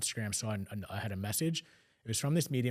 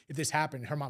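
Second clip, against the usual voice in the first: usual speaking voice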